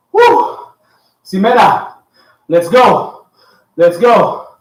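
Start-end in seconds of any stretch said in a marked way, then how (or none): 0.1-0.6 high whooping cheer; excited whoop. Real, not acted. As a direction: let out a big whoop. Coach cheers.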